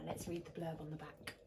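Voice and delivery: ordinary delivery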